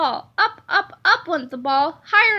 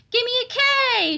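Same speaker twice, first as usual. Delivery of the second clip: cheerleader shout; 8 semitones higher than usual. Acted. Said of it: "shouted the cheerleaders".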